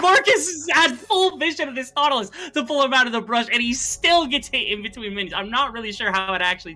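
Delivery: high, loud, laughing with hype; excited laughing. Real, not acted. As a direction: gush over the play, laughing. Co-caster hyped about hook.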